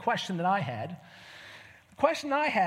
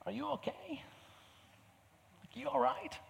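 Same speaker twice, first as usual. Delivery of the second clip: gentle concern; 6 semitones higher, 9 dB quieter than usual. Pretend. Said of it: "what I would say".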